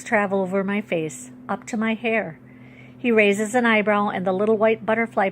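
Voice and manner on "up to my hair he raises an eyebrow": usual speaking voice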